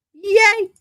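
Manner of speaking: high happy yay; excited cheer. Real, not acted. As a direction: celebrate a small win. Cheers after finishing recording.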